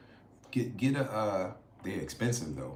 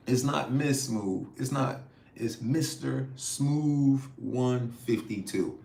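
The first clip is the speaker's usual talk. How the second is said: slow, higher, firm Mister Smooth; emphatic correction; 4 semitones higher, 3 dB louder, slower than usual. Real, not acted. Setting a viewer's name straight, slow and emphatic. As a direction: spell out the right name deliberately. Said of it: "I keep saying".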